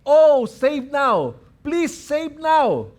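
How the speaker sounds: high, drawn-out plea; stretched, high-pitched appeal; pleading cry. Acted. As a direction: cry out slowly for rescue. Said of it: "shouting, crying out".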